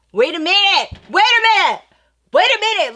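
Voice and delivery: loud, high halting cry; exaggerated shock, each repeat a fresh outburst; stunned disbelief. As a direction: stop everyone in their tracks. Retold stunned halt at revelation.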